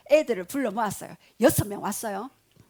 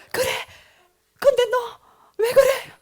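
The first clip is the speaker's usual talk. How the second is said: imitated weeping mother; 12 semitones higher, 8 dB louder than usual. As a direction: act out a mother asking through tears. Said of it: "어머니도 막 울면서". (울부짖듯 묻는 엄마 흉내)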